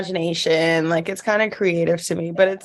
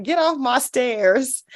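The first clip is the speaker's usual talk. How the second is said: slow, drawn-out mock sleep talk, pitched up; sleep-talking child; 4 semitones higher, slower than usual. Mom imitates son talking in his sleep, slow higher drawl. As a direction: imitate a kid ordering someone off in his sleep. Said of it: "he was talking in his sleep".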